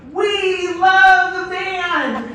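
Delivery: long, high, loud cheer; calling to the band. Retold or imitated. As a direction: draw out every word in a big shout. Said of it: "he'll say".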